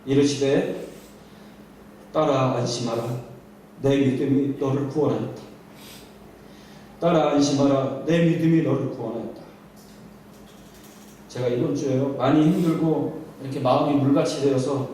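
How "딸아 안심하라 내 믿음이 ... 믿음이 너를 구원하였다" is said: low, gentle reassurance to the daughter; Jesus warmly reassuring the frightened woman. Acted. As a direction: speak as a tender father comforting his child. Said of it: "따뜻한 목소리로 이렇게 말씀해 주십니다".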